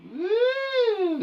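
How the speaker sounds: high, held ghostly woo; loud high-pitched playful hoot. Pretend. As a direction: make a long spooky woo. Imitates the spooky fog word.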